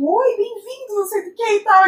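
voz de garçonete bem mais aguda e melosa; garçonete deslumbrada. Retold or imitated. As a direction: imite a atendente toda derretida. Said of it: "a garçonete fica, tipo". (garçonete encantada, voz aguda e melosa)

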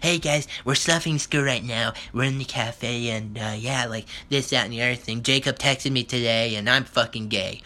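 mocking ASMR mukbang voice; mimicking girl vlogger. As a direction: put on a vapid influencer's chatter. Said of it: "they're giggling with their fucking friend".

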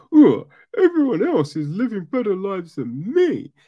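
high, loud tearful whine; hammed-up crybaby complaint; mock whining. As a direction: imitate a self-pitying whiner. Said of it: "where he's whining".

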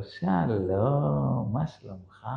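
soft, long drawn-out hello; sweet greeting. Retold or imitated. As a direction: greet him as if nothing had happened. האישה מברכת כאילו כלום. (ברכה מתוקה ומשוכה)

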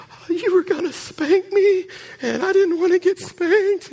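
put-on high tearful child's wail; crying child. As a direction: wail it like a weeping little boy. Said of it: "just crying".

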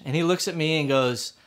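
usual speaking voice

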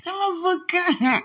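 high delighted coo; excited coo. Real, not acted. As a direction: croon her name happily. Excited outburst.

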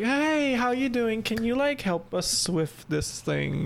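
high, sugary imitation of a friendly teacher; mock cordial. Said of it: "she was so cordial with you".

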